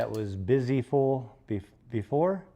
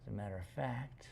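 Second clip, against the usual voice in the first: hushed, softer stern reply; quiet stern no; 3 semitones lower, 13 dB quieter than usual. Retold or imitated. Owner telling the puppy it's not fun, hushed. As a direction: drop to a grave near-whisper. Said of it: "And I said".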